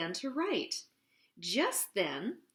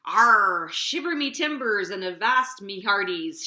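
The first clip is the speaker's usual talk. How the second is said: loud pirate captain roar; 2 semitones lower, 7 dB louder than usual. Acted. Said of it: "she roared".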